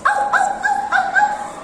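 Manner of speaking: very high yapping woofs; dog barks. Shown as a demonstration. Teacher barks like the dog. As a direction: bark like the little dog.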